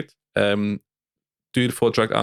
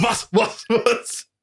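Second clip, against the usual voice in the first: stunned what; 13 semitones higher, much slower than usual. Retold or imitated. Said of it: "Ich so".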